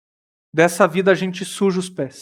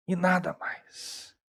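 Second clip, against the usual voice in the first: hushed echo; 2 semitones lower, 14 dB quieter, slower than usual. Acted. Repeated fading close.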